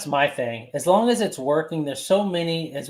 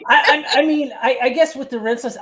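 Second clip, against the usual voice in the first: high, louder giggly start; laughing at herself while confessing; 12 semitones higher, 5 dB louder than usual. Real, not acted. Detailer admits to the hosts she caved on rinseless, laughing.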